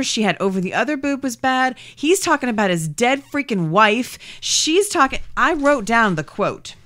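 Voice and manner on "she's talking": ordinary talk, nothing marked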